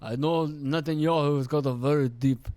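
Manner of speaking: attempted Israeli accent; badly faked Israeli accent; Netanyahu. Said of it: "I can't do his accent".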